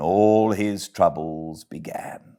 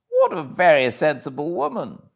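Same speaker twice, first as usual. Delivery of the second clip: lightly raised, sympathetic gentleman's voice; a hint of a concerned gentleman; kindly gentleman; 8 semitones higher than usual.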